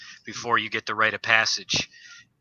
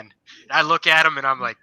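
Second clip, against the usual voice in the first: ordinary talk, nothing marked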